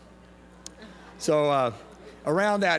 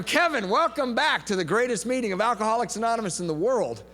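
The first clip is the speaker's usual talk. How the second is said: mildly hammed-up greeting, pitched high; hearty welcome; 7 semitones higher than usual. Member greeting a returning newcomer at AA, hearty mock welcome. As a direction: welcome him back like a proud host.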